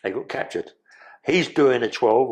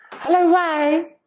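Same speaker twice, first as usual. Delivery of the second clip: very high, slow, drawn-out "Hello, Ray"; a camp falsetto greeting through the cell door; weird high voice; 5 dB louder, much slower than usual. Retold or imitated. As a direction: croon it in a strange high falsetto. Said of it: "I better not talk like that. It sounds a bit weird".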